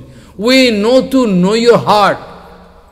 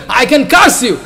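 laying a curse; 5 semitones higher, 4 dB louder than usual. Retold or imitated. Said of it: "some Brahmin become very angry".

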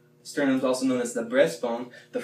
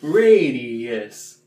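punched, louder second radius; 6 dB louder than usual. Shown as a demonstration. Teacher showing the louder second radius. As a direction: push the second radius out loud. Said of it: "we're gonna cross the bones".

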